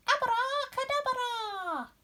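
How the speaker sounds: very high, slow spell shout; high, drawn-out witch's incantation. Acted. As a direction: cast it with a flourish.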